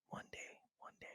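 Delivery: whispered mock of procrastinators. Speaker mimicking people who put things off, in a whisper. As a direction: hush it like a lazy excuse.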